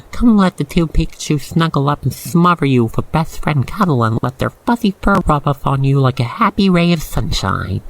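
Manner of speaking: usual speaking voice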